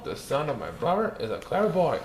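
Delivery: plain reading voice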